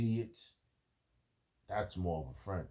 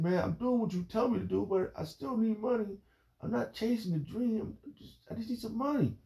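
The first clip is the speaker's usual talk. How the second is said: friend complaining; 3 dB louder than usual. Retold or imitated.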